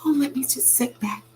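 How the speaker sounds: very high, meek squeaky voice; squeaky mock submission; mock timid voice. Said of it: "I'm not gonna sit down".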